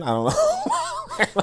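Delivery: laughing. Real, not acted. Mocking the comment.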